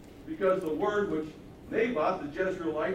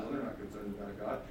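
ordinary talk

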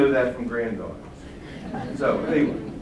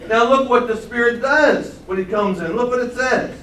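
ordinary talk, nothing marked

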